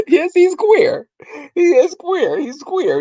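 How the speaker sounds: high-pitched, drawn-out confirmation; amused insistence. Retold or imitated. Answering mama's question, high amused insistence. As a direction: repeat it in mock exasperation, voice way up. Laughing mock answer to grandmother.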